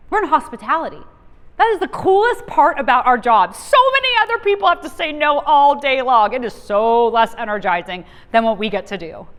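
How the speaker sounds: loud, enthusiastic pitch; excited. Real, not acted. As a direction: sell the joy of the job, full voice. Enthusing about hospitality work.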